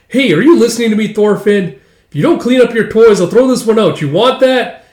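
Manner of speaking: high, loud, exasperated telling-off; scolding parent. Acted. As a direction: scold a child who won't listen. Mother scolds child over toys.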